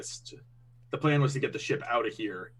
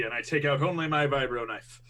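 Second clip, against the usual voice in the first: ordinary talk, nothing marked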